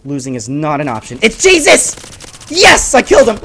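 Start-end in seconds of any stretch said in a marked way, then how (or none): 1.2-3.4 high, loud burst of triumph; frantic gamer cheer; excited shouting. Real, not acted. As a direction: yell out the sighting and the kill. Excited kill in close match.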